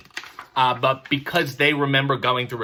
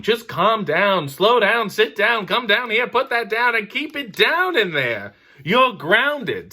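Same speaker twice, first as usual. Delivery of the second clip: higher, clipped parental orders; mild mock parent scolding the kid; 7 semitones higher, slower than usual. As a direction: rattle off commands to the kid.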